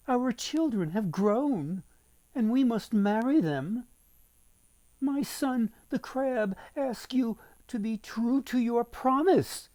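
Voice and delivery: bow to the queen with a lifted voice; priest's wife. Acted.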